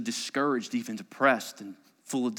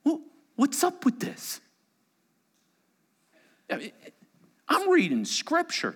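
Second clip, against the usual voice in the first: high, pleading complaint to God; frustrated believer. Acted. Churchgoer complaining to God, high and pleading. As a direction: protest like a hurt family that did everything right.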